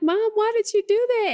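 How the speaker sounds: put-on high, hurt little-boy voice; imitating son. Son asking mom why she locked him out, in a high kid voice. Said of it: "he was like".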